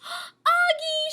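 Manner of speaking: high, loud cry. A narrator's reading. Nell finding the tied-up prince, high cry. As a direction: cry out his name in relief. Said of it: "she cries".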